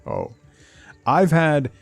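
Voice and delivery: usual speaking voice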